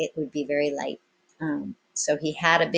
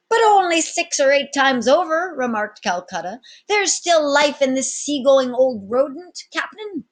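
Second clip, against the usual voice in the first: put-on old sailor protesting; 10 semitones higher, 8 dB louder than usual.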